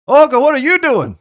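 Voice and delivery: shouted high and loud across the room; calling out. Acted. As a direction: yell her name to wake her. Melvin calls across to Olga.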